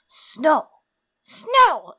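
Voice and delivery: loud, high bird squawk; cawing raven. A narrator's reading. Old raven calls to John, cawing. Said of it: "it cawed".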